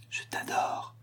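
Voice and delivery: murmure intime, sans voix; souffle-le contre son cou; chuchoté à l'oreille. A narrator's reading. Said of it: "lui dit Max à l'oreille". (Max chuchote à l'oreille de Berthe)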